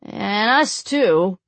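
slow, lightly voiced goon; a hint of a dim henchman, drawn out; reminding henchman. A narrator's reading. Whip wielder reminds the priest, lightly in character. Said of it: "the whip wielder reminded".

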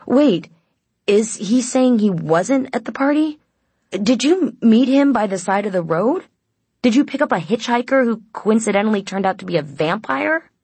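high, loud, mounting disbelief; incredulous questioning. A narrator's reading. Winter challenges the hitchhiker story, loudly incredulous.

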